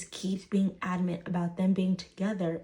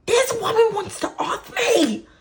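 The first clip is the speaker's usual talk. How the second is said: high, very loud comic yell; exasperated shout; 14 semitones higher, 10 dB louder than usual. Retold or imitated. Daughter to her laughing dad, exasperated shout. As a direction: shriek it in disbelief at dad.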